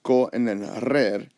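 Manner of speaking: "co", then "rer" as separate syllables; shown pronunciation. Shown as a demonstration. Teacher explaining the split, "co" and "rer" pronounced apart. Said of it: "even though it's pronounced".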